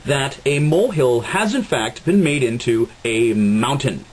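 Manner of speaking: hammy news anchor voice; old radio announcer. Acted. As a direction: play the smarmy TV host. Plays news anchor host.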